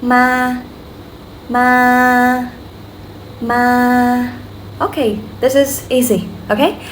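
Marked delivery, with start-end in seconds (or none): 0.0-4.3 "ma" repeated long and level; flat tone. Shown as a demonstration. Teacher repeats the no tone example, flat and long. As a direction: hold each "ma" on one steady pitch. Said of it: "is flat and a little bit long".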